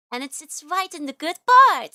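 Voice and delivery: loud anguished complaint; exasperated outburst. Real, not acted. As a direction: burst out in frustration. Complaining about crack placement.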